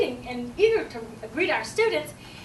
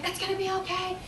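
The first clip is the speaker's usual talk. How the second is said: soothing, slightly lifted voice; calm reassurance; 5 semitones higher than usual. Acted. Teacher soothes the crying child, calm reassurance. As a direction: reassure without raising the volume.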